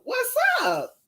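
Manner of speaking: high, excited squeal of a hello; a delighted squeak to a cousin; squealed greeting. Real, not acted. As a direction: squeal it like you've spotted family.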